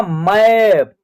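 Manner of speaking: मैप with a long open 'ae'; AI vowel in मैप. Shown as a demonstration. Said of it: "ए का उच्चारण ऐ हो रहा है". (मैप में लंबा ऐ)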